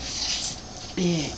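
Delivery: ordinary delivery, nothing marked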